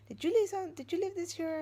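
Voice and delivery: put-on questioning the kids. Mother grilling her kids about moved things, suspicious.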